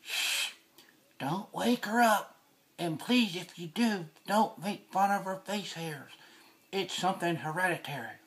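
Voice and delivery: hushed and soft, slow; whispering over a crib. Real, not acted. Sneaking in near sleeping baby.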